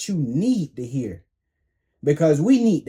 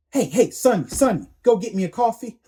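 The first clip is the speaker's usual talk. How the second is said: higher, fast bossy boss call; mock bossy boss; 5 semitones higher, faster than usual. Boss ordering the worker to fetch coffee, higher, fast and bossy. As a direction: rattle off orders like a demanding boss.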